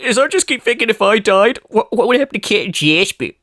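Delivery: high-pitched imitation of tearful self-pity; mock crying. Imitating him crying over his own death, mock tearful. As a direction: blubber it in mockery. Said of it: "And he's crying 'cause he's like".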